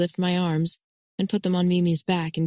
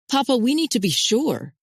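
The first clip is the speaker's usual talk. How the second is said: urgent; 7 semitones higher than usual. Acted. Son insisting to his father, urgent and raised. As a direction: push back at Papa with urgency.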